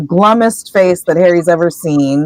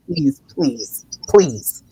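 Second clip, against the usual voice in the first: low, hushed begging aside; glaring plea; 4 semitones lower, 7 dB quieter, much slower than usual. Retold or imitated. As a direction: plead under your breath as a warning. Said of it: "glaring at Peeves".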